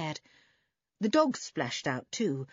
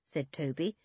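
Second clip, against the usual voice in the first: plain reading voice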